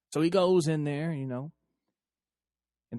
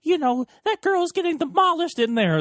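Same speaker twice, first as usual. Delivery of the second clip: much higher put-on woman's voice; high mock-scolding girlfriend; 12 semitones higher than usual. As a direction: mimic her disapproving tone in a high voice. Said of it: "She's like".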